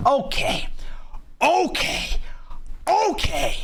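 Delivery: high, dazed okay, okay; stunned high okays. Real, not acted. Hearing the needle goes in his ass, stunned.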